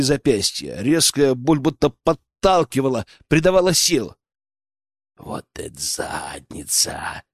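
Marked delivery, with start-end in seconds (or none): 5.2-7.2 very low, slow, soft grunting leer; piggish grunt. A narrator's reading. Poacher groping his captive, grunting with relish. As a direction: grunt it out lecherously. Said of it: "хрюкнул ублюдок".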